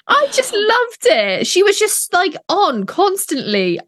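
high, slow gushing; gushing excited. Real, not acted. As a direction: rave about her style. Gushing about Madame Macron.

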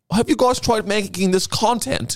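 high, eager mock pitch; lightly voiced eager influencer; mock eager Jay. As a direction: pitch it like a keen hustler. Imitating Jay to monks.

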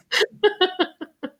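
high-pitched burst of laughter. Real, not acted. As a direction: laugh at your own story, pitch shooting up. Laughs at own story.

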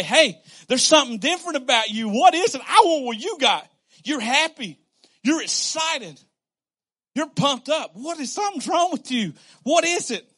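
loud, high, eager questions; excited asking. Pretend. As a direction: ask like you can't believe the joy you see. People asking what's different.

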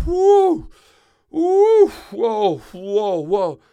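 very high loud whoops easing down; whooping. Acted. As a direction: hoot with relief, then settle. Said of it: "he comes out and he goes".

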